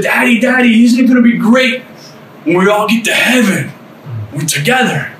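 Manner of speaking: put-on high, eager five-year-old voice; excited little child. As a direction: imitate a small child asking Daddy. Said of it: "My littlest one, who was five then".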